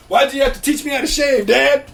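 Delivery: loud, high-pitched mock complaint; mock outrage. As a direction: yell at your parents in playful protest. Mock rant at his parents.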